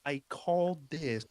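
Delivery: hint of a smug, high brag; smug mock voice. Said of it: "Subscribe gone".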